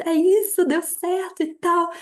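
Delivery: mock celebratory yell, pitched high; retold excited shout. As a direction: imitate yelling good news to your partner, without full volume. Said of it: "saí gritando". (grito de comemoração imitado, agudo)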